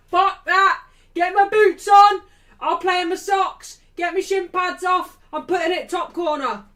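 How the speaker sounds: loud, high mock shouting; hammed-up shouts of sudden eagerness; fired-up player, acted. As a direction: yell it like a player desperate to get on. Imagined fired-up player's reply.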